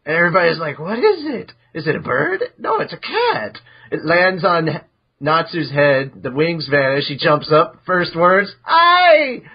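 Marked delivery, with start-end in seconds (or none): 0.8-3.6 confused questions jumping to a much higher squeal; mock astonished. The guild gaping at the newly hatched cat, rising to a high squeal. 8.6-9.4 tiny creature chirp, much higher and louder; Happy's chirp. Acted. Newborn cat announcing himself to everyone, high, loud chirp.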